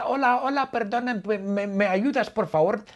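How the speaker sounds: high-pitched, hesitant granny plea; abuela pidiendo ayuda. Acted. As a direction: beg politely as a high-voiced old lady. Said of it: "yo soy una pobre abuela". (voz aguda de abuelita que pide ayuda)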